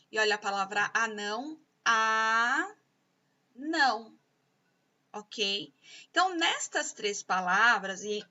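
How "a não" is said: a long held "a", a pause, then "não"; syllable by syllable. Shown as a demonstration. Teacher dividing anão for pupils, syllable by syllable. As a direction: split "anão" slowly into two syllables. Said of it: "sílaba por sílaba".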